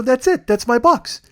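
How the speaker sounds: high, loud exclamation; high-pitched excited pointing-out; urgent insistence. Retold or imitated. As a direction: pipe up urgently on spotting it. Spots his box, insists.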